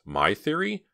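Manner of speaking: high surprised echo; surprised question. A narrator's reading. Myra surprised.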